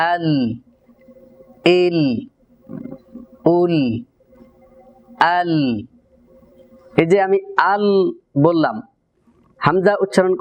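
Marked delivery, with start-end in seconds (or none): 0.1-5.5 আল, ইল, উল with a half-held lam, neither fully flowing nor fully stopped; sakin lam drill. Shown as a demonstration. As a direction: clip each syllable on the lam. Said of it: "আমরা যদি সাকিন উচ্চারণ করি". (সাকিন লামের অনুশীলন)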